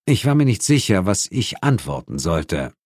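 usual speaking voice

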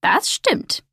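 lightly croaked crow voice; a hint of crow squawk; croaking crow. A narrator's reading. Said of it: "krächzte die Krähe".

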